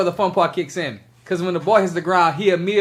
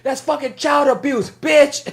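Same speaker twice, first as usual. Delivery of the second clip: put-on high, slow, indignant kid's rant; in-her-face mock yelling; 9 semitones higher, 3 dB louder, much slower than usual. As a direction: imitate a boy screaming abuse at a lady. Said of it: "He's like in this lady's face".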